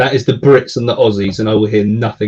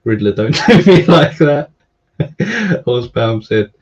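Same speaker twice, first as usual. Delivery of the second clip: ordinary talk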